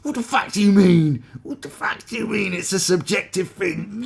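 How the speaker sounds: high, loud, baffled fury; outraged because it went over his head; angry short man. Acted. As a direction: shriek it as a furious demand. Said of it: "goes over angry short man's head".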